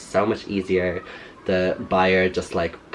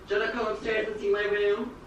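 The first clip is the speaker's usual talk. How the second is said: calling; 11 semitones higher, 6 dB quieter than usual. Real, not acted. Calling mother from upstairs.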